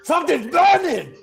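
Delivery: high, loud cry of alarm; alarmed shout. Acted. NPC shouts to the party that something's burning. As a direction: yell it out in sudden panic. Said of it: "And he goes".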